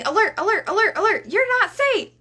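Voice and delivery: high, loud panicked alarm voice; brain alarm. Acted. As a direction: sound the alarm like a frightened brain.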